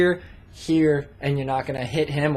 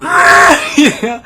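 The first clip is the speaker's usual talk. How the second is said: laughing; 10 semitones higher, 13 dB louder than usual. Real, not acted. Laughing mid-sentence about censoring.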